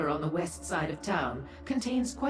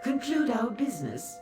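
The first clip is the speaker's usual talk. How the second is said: high, thrilled echo of a question; teasing delight; 8 semitones higher than usual. Acted. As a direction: pounce on it with coquettish glee. Delightedly echoes Lyranth's business talk.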